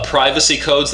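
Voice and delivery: ordinary talk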